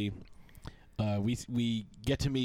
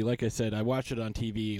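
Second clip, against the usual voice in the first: normal talking voice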